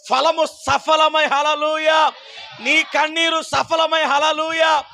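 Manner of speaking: fervent call and shout of hallelujah; preaching at full force. Acted. Preacher rallies the congregation, full-force preaching. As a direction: proclaim each blessing with rising fire. Preacher proclaims answered prayers.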